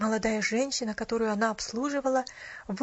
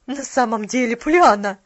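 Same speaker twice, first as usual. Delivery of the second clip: loud, reproving interjection; clear disapproval; 12 dB louder than usual. A narrator's reading. Mrs. Carew starting to object, openly disapproving. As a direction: build to a loud rebuke on the name. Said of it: "с явным осуждением".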